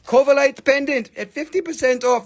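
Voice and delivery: loud hyped auctioneer pitch; full blast. Acted. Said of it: "Now we are going full blast".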